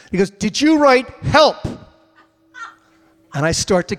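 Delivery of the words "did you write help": loud, slow stern cop voice; imitating the trooper. Trooper asking the driver about the sign, loud stern voice.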